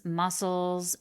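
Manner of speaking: ordinary delivery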